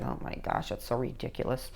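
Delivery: whispered scornful muttering; hushed, low, exasperated aside; eye-rolling disdain. Acted. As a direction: grumble under your breath at a childish teen. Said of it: "Don't be rolling your eyes like".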